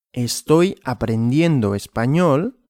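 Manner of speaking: ordinary talk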